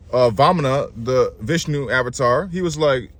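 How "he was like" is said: normal talking voice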